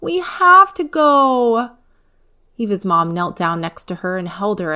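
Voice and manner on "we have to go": put-on high, loud child's upset wail; a little girl pleading through tears; tearful protest. A narrator's reading. Eva begging Mom after park day is cancelled, upset wail. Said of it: "Eva burst into tears".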